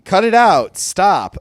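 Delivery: put-on a girl yelling at the two to stop; sharp protest. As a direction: shut the quarrel down. [name] snaps during the reading.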